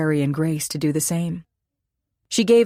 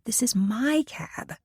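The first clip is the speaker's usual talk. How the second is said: whisper; 7 semitones higher, 5 dB quieter, slower than usual. Acted. She hails cab, someone approaches.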